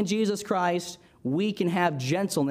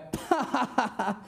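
high, mocking burst of laughter; kids laughing back; 5 semitones higher than usual. Kids laughing at the Sunday school teacher who yelled at them, a high mocking laugh. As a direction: laugh in his face like a cheeky kid. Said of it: "Connor and Evan looked at me and then went".

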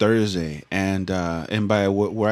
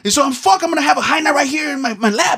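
high, loud, fast mock old man shouting; grumpy old man; 4 dB louder, faster than usual. Grumpy old man ranting at the runner, high and loud. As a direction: rant like a cranky old guy. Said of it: "grumpy ass motherfuckers".

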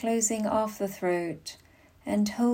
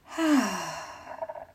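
long, falling sigh on a full exhale; deep sigh. Shown as a demonstration. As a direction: let the whole breath go in one audible sigh. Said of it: "exhale with a deep sigh".

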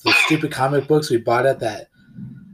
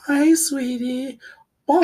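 much higher, slow mock-sweet mother; high mock mom; 12 semitones higher, slower than usual. Joker voicing a doting mother, high and slow. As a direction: coo it like a mom.

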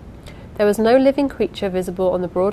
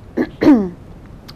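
a quick, loud throat clearing; 6 dB louder than usual. Real, not acted. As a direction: clear the throat before reading on. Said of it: "I again had to pause the recording".

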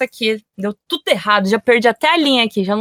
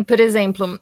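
ordinary talk, nothing marked

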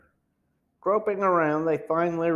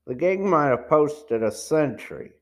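ordinary delivery

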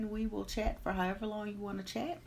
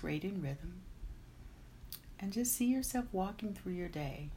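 guided meditation; 4 dB quieter than usual. Acted. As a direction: guide them calmly into the day. Leads a meditation.

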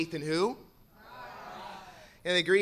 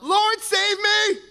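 Peter cries out high, slowly; cried out; 9 dB louder, much slower than usual. Acted. Sinking Peter cries out to Jesus, high and slow. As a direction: plead like a sinking man. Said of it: "cried out".

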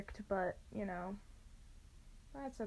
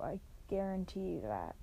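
ordinary talk

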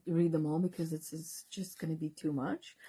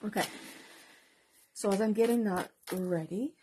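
ordinary talk

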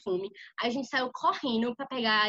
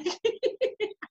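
much higher, talking through real laughter; can barely get words out for laughing; high giggling half-sobbed words; laughing hard; 8 semitones higher, 4 dB louder than usual. Real, not acted. Laughing while retelling the chaos.

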